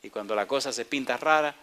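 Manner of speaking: normal talking voice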